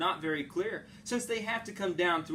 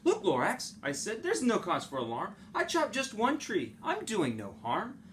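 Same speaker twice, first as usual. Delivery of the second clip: Onceler's salesman voice; 7 semitones higher than usual. Acted.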